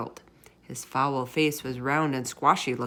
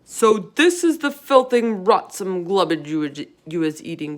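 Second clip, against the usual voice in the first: booming disgust; 6 dB louder than usual. A narrator's reading. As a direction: boom it in revulsion. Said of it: "boomed the blood bottler".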